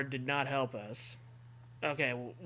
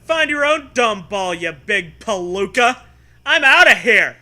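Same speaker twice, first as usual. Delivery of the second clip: put-on high, loud old-timey taunt; huffy girl storming off; 5 semitones higher, 13 dB louder than usual. Zoe insulting the kid as she leaves, loud and high.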